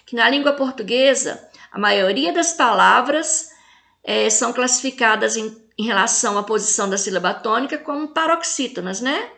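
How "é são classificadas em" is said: ordinary talk, nothing marked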